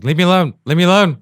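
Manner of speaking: high, loud mock yell; retold snapping protest. Acted quarrel before the show.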